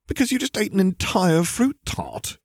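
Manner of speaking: high, incredulous exclamation; acted disbelief, pitched right up; exclaiming. A narrator's reading. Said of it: "he exclaimed".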